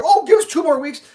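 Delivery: mocking the support line; mocking rep. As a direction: sneer their excuse back. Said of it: "now you're like".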